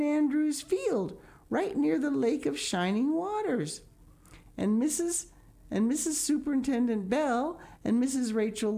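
put-on high, eager childish rush; excited Anne. A narrator's reading. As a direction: gush like a thrilled girl. Anne excited about picnic.

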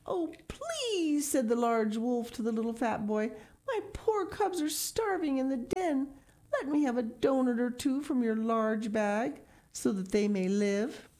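high, pitiful begging wolf voice; hungry wolf begging a boy for donuts; pleading wolf. Acted.

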